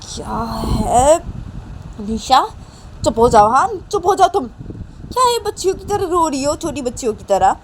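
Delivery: sharp high-pitched telling-off; scolding mother. Acted. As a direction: order a crying child to stop. Mother scolding crying Nisha. (चिढ़कर डाँटती माँ)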